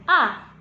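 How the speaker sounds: "A" falling sharply, as if angry; short "A" with a hard downward stroke; fourth tone. Shown as a demonstration. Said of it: "Nada keempat".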